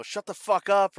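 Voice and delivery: retold angry yell; imitated yelling. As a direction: snap it like an irritated stepfather. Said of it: "he starts yelling".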